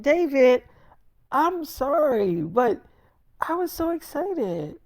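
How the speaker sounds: high, drawn-out mock girlfriend voice; imitated pleading apology. Girlfriend apologizing to David for telling family first, high mock pleading.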